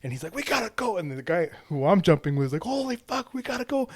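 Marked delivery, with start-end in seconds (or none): 0.3-1.0 high urgent cry; uncle's high frantic call to jump; urgent alarm. Retold or imitated. Uncle rushing out after kid. 2.6-3.9 high laughing panic; a high, half-laughing retold panic; panicked yelp. Jump partner panicking.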